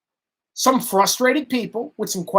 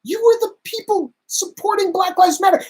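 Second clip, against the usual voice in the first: much higher, incredulous retort; exasperated retort; 11 semitones higher than usual. Retold or imitated. Telling liberal friends off, incredulous and high. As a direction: throw it back at them in disbelief. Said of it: "And I'm going".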